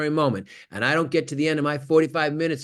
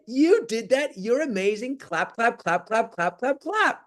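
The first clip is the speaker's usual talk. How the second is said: put-on higher, sing-song baby praise; praising parent; 8 semitones higher, slower than usual. Proud parent cheering a baby's first step, high and sing-song.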